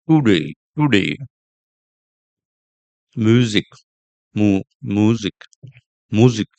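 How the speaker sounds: TODAY and MUSIC with stretched 'oo'; exaggerated long oo. Shown as a demonstration. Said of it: "exaggerating the sound".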